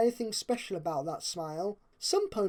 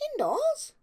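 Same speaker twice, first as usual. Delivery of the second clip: much higher, rising squeak of shock; disbelieving Pinkie. A narrator's reading. Pinkie hearing Rarity's indoor plan, aghast. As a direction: she can't believe her ears.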